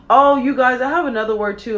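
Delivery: suddenly thrilled to share; excited. Real, not acted. Telling viewers she has another word, excited burst.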